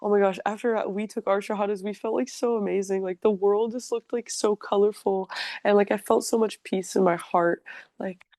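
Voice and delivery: bubbly and high-pitched, gushing over how amazing it felt; high, gushing girly excitement; gushing excited sisters. Retold or imitated. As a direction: imitate the sisters raving breathlessly about their joy. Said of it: "Kendra and the other sister were like".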